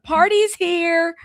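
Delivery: drawn-out, high party announcement; Snooki voice. Acted. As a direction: stretch it out high like a Snooki catchphrase. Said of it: "in my Snooki, uh, voice".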